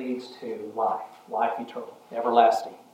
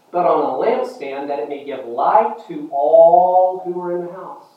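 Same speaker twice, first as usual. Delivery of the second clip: higher, louder pulpit recitation; declaimed Bible verse, raised voice; scripture proclamation; 5 semitones higher, 5 dB louder than usual. Acted. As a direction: proclaim the verse with swelling emphasis. Quotes scripture to congregation.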